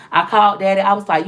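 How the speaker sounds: ordinary talk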